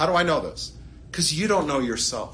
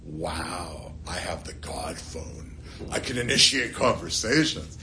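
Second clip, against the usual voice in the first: low, hushed mock-awed boast; low, quiet put-on grandeur; 8 semitones lower, 3 dB quieter than usual. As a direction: play a self-important boaster, slow and low. Imagined boast Moses never made.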